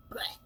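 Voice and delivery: short imitated "bleh" of throwing up; mock retch. Retelling being fed while hungover, mock retch. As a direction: make a low mock gagging noise.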